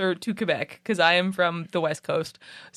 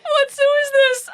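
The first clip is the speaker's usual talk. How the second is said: high, squeaky, disbelieving repeat of the question; incredulous squeal; 4 dB louder than usual. Retold or imitated. Co-host riffing on the walrus zoo, incredulous high squeal. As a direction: squeal it up high like you can't believe it.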